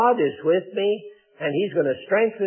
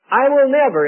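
"I will never" pitched high, firmly stressed; emphatic promise; 6 semitones higher, 4 dB louder than usual. Retold or imitated. Preacher quoting God's promise to believers, emphatic. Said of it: "it's very emphatic".

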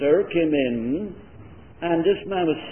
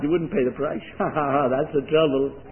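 normal talking voice

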